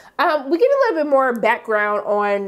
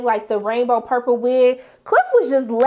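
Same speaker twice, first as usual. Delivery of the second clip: normal talking voice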